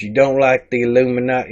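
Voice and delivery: low-pitched, muted read-out; low-key comment reading. Acted. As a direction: read the accusation low and unexcited. Reads the comment before his retort.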